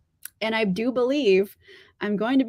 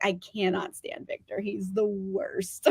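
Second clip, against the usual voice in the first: fed-up mutter; 3 semitones lower, 7 dB quieter than usual. Retold or imitated. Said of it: "And I'm like".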